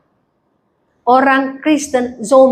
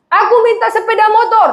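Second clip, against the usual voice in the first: put-on small child asking; 8 semitones higher than usual. Pastor voicing a child who demands a motorbike, whining. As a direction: play the five-year-old begging for a motorbike.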